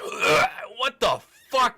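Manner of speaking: high, slow gag into an exclamation; retching in disgust. Acted. Game master voicing a guard hit by a foul smell, retching. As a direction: gag on it, then exclaim in revulsion. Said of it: "You guys hear him retching".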